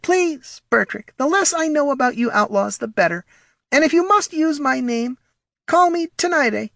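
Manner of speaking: very high, prissy little voice; fussy, superior small kobold; haughty kobold Morty. Acted. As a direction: lecture primly in a tiny high voice.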